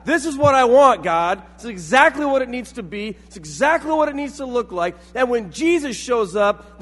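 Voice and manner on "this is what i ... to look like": high, insistent dictating of terms; demanding. Acted. People telling God their exact order, loudly insistent. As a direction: insist point by point, voice raised. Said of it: "basically told God".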